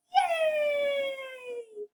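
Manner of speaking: very high, drawn-out squeal; high piggy celebration; cheering little pig. Acted. Repeats the pigs' cheer.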